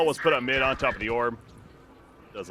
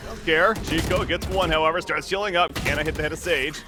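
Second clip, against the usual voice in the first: live play-by-play call; esports caster. Real, not acted. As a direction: call the action as it happens.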